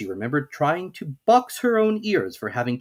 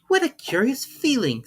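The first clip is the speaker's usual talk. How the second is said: put-on much higher, wondering girl; Alice's voice; 14 semitones higher than usual. A narrator's reading. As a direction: marvel at the odd feeling. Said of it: "said Alice".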